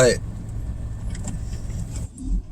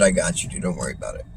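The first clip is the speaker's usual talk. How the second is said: low, very soft, casual reassurance; hushed and low, no big deal; quiet reassurance; 11 semitones lower, 8 dB quieter, faster than usual. Retold or imitated. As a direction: let them off easy, voice dropped right down. He reassures the homeless man.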